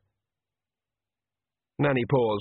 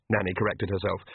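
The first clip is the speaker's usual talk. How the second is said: ordinary delivery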